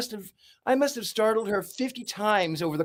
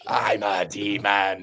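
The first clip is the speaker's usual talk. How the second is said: mock demon voice; 7 semitones lower, slower than usual. Joke demon line repeated.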